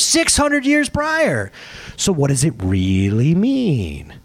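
ordinary talk

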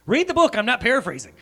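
loud, high-pitched comic outburst; mock scolding. Preacher jokingly tells congregation to read it, mock scolding. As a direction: burst out in playful self-defence. Jokingly defends his retelling.

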